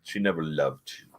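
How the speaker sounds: low, soft retold menace; dramatic quote. Savouring a quoted dramatic line.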